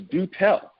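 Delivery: high, drawn-out mock sarcastic do tell; dry do tell. Federal agent prodding the traveler, mock dry sarcasm. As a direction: tease it out of him, lightly sarcastic. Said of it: "And he goes".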